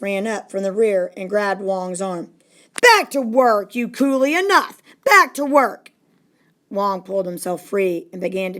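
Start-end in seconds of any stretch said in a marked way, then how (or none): 2.8-5.9 loud, harsh shouted order; furious command; angry boss. Acted. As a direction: bark him back to work. Boss grabs Wong's arm.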